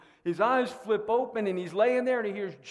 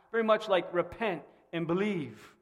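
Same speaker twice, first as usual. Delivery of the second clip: normal talking voice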